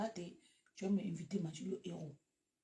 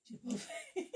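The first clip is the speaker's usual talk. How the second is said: laughing; slower than usual. Real, not acted. Laughs at her own remark.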